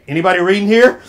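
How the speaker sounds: loud rising question to the room; insistent repeat. Real, not acted. Speaker retrying an unanswered question on the audience, loud and insistent. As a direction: belt out the question to get a response. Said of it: "Let's try it again".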